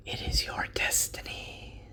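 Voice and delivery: breathy whisper from the dark. A narrator's reading. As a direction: whisper it like a voice luring him on. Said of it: "the whisper says".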